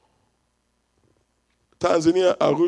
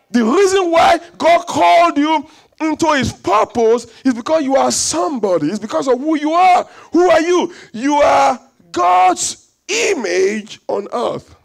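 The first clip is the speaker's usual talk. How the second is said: higher, louder, fervent preaching; full-force preaching; 6 semitones higher, 4 dB louder than usual. Acted. Pastor preaching to the congregation about being God's image, fervent shout. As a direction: lift the room with a fervent cry.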